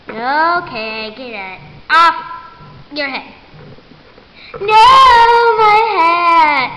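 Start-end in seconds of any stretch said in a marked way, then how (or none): 4.5-6.7 loud, very slow wailing cry; mock crying wail. Ribbits loses his cowboy hat, loud slow wail. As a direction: wail over the lost hat.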